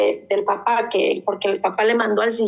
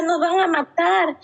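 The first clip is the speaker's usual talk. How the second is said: higher, fearful whimpered warning; scared child; 7 semitones higher than usual. Retold or imitated. Mother voices daughter's nightmare words, frightened. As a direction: sound like a child sure they'll be killed.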